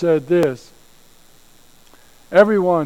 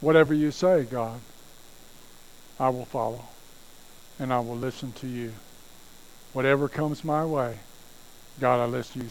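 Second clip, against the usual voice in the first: soft, low, devoted; surrendered prayer; 4 semitones lower, 7 dB quieter, faster than usual. Acted. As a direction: whisper it as total surrender. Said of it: "aggressive listening says".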